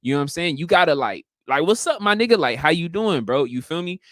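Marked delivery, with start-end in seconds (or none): 1.6-3.4 a higher, wholehearted hello; enthusiastic greeting. Shown as a demonstration. Showing how to greet a friend, all-in and enthusiastic. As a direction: greet with full commitment. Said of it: "You gotta be all the way in".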